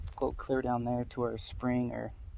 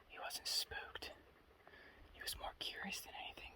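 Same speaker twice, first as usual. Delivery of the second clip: wildlife watching whisper; 13 semitones higher, 12 dB quieter than usual. Real, not acted. Still whispering near the deer.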